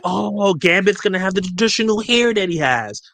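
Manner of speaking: high-pitched mock awe; playful put-on amazement; imitating amazed fan. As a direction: coo like a fan seeing a leak. Voices a fan fooled by picture.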